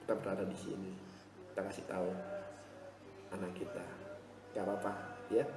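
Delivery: put-on calm a child down softly; gentle with child. Shown as a demonstration. Contoh bicara lembut ke anak. (lembut menenangkan anak)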